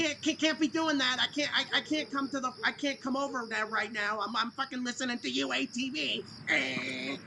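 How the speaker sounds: a lightly done, whiny brush-off of mom; whiny man-child. Pretend.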